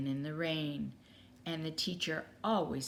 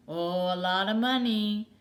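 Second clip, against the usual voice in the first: put-on old carpenter voice; 3 semitones higher, 8 dB louder than usual.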